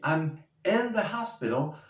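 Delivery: in in "I'm in the hospital" leaned on; stress on in. Shown as a demonstration. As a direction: lean on the word in. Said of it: "Pero si digo".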